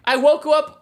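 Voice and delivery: normal talking voice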